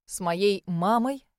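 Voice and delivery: slow, pressed question on мамой; with emphasis. Acted. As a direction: lean on моей мамой slowly. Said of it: "повторил Эйден с нажимом".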